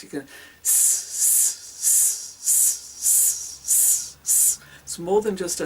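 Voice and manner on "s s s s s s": series of short separate hisses; pulsed hiss. Shown as a demonstration. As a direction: hiss in steady bursts from the diaphragm. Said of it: "you can do a hiss".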